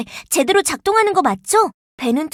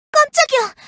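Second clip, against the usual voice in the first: startled yelp; 10 semitones higher than usual. Acted. Startled by a sudden scare.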